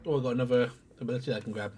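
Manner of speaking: usual speaking voice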